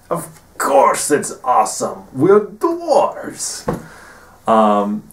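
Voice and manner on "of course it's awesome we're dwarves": higher, louder proud boast on dwarves; proud dwarf. Retold or imitated. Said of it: "surface thoughts are basically".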